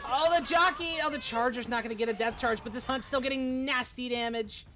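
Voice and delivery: rising excitement, peaking on the jockey; lively live esports call; animated play-by-play. Real, not acted.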